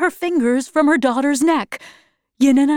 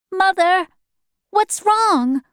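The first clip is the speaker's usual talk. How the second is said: put-on higher-pitched, anxious young voice; worried daughter; 8 semitones higher, slower than usual. A narrator's reading. Yanina asking her screaming mother, worried.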